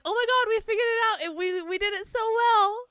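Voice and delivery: high, loud, gushing quote; mock excited. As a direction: gush like you just succeeded. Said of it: "we're like".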